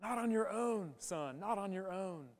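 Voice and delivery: a quiet, fatherly correction; God's soft voice. Retold or imitated. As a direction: say it softly, as God speaking close to your ear. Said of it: "God has whispered in my ear".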